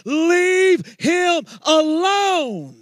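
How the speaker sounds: high, loud and very slow cry; preached shout. Acted. Pastor cries out to the church to let the worshippers be. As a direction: peak of the sermon, each word flung out.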